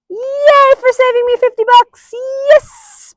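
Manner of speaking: very high, loud, shouting praise; thrilled squeals. Real, not acted. Trainer thrilled her dog keeps standing, squealing yes. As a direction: squeal with delight at every success.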